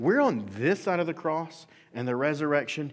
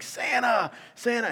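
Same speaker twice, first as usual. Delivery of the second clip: high, louder giddy cries; excited Buddy; 13 semitones higher, 6 dB louder, slower than usual. Retold or imitated. Buddy thrilled that Santa is coming, high excited cries. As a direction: squeal the name like a thrilled child. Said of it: "He's literally excited".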